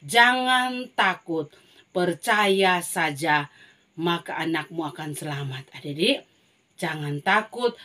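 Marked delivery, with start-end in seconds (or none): 0.1-5.7 slow, word by word; slow recitation. Shown as a demonstration. Teacher recites the verse slowly for children. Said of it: "ada berapa kata itu".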